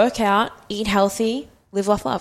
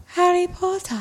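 mock dreamy voice; 10 semitones higher than usual. Mock Luna voice.

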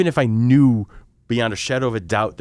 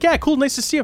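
awkward high reply. Retold or imitated. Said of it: "And I'm like".

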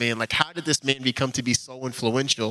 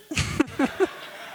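breathless high-pitched giggle. Real, not acted. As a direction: let out a high airy giggle. Laughs after getting the seat.

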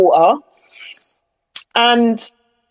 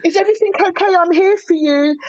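mock sugary concern, pitched much higher; gushing commenter; 8 semitones higher than usual. Mimicking commenters fussing over a sad post, sugary and high. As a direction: coo it like an overly caring online friend. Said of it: "you got people saying".

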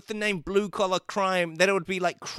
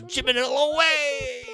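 villain exit cry; 10 semitones higher than usual. Pretend.

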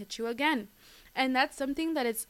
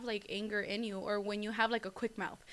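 ordinary delivery

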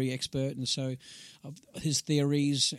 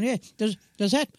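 high, loud, stuttering question; pitched-up mock fluster; flustered shoe seller routine; 11 semitones higher, 7 dB louder than usual. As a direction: stumble upward into a comic, awkward question. Acted awkward shoe fitting.

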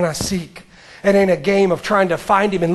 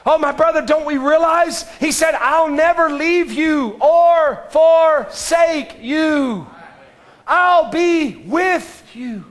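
a preacher's fervent climax; preaching at full force; 8 semitones higher, slower than usual. Real, not acted. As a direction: stretch each word out over the congregation.

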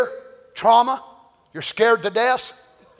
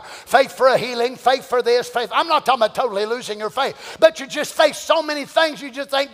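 normal talking voice

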